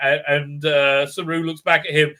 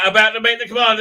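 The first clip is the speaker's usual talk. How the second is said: normal talking voice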